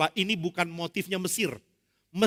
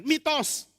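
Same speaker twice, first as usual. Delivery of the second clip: scoffing; 10 semitones higher than usual. Acted. Dismissive critic's exclamation.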